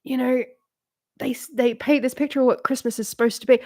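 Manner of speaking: usual speaking voice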